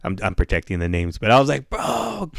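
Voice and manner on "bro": very high, soft calling voice; stoned plea. Retold or imitated. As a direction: imitate a helpless stoned call, high and small. Stoned, calling to friend from bed.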